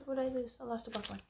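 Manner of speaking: normal talking voice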